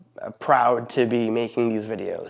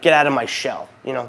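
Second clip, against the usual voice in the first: usual speaking voice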